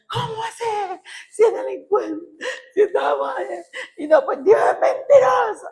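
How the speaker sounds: very high tearful wail, acted out; retold wailing protest. Believer accusing God after a death, wailing. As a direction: re-enact crying out in angry grief.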